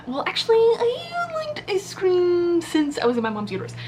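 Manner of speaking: high, slow, drawn-out one-upping; hammed-up "well, actually" brag; mock know-it-all. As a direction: stretch it out high like a smug gatekeeper. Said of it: "I'm not gonna be like".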